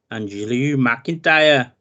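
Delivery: put-on slow, drawn-out Scottish accent. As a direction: drag out the name in a Scottish accent. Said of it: "That was my Scottish accent".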